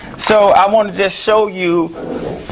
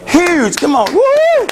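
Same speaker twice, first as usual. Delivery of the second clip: excited cheer; 11 semitones higher, slower than usual. Real, not acted. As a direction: cheer it like a win. Cheering the program's growth.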